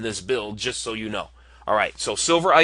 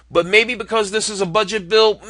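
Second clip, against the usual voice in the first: high, musing budget caveat; high-pitched hedge; 9 semitones higher, 3 dB louder than usual. Real, not acted. Reviewer weighing a budget build, high-pitched hedge. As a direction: float the whole phrase up high.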